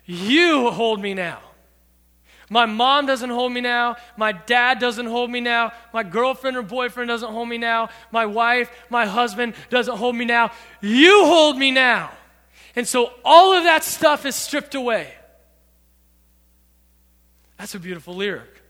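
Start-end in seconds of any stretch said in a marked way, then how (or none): 0.1-1.4 "You hold me now" with a loud, slow "You"; stress on YOU. Shown as a demonstration. Pastor repeating the line with weight on "you". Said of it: "You should be the emphasis". 2.5-15.1 louder, rising preacher's build; preaching. Acted. Youth pastor preaching to teens with full force.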